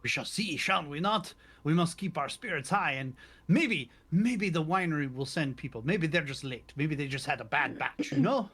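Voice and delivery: put-on lilting Italian accent, hopeful; upbeat Italian accent. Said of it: "Italian [name] is killing me".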